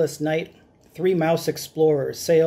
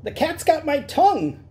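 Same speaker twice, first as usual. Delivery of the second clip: high squeaky complaint; panicked butcher; 11 semitones higher than usual. Acted. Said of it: "squeaked the butcher".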